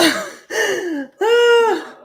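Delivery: high, loud burst of laughter. Real, not acted. Reacts to a viewer's comment.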